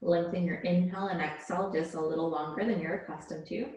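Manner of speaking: calm, measured yoga-teacher voice; unhurried relaxation-class delivery; guided yoga meditation. Real, not acted. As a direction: guide them gently into their breath, leaving space between phrases. Leads yoga breathing meditation.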